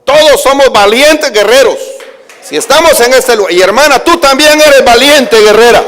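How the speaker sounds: proclaimed loudly to the church; loud preaching. Acted. Preacher telling the congregation they are brave warriors, loud preaching. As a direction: declare it over the people.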